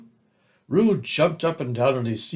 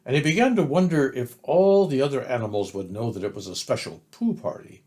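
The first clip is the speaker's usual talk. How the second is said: usual speaking voice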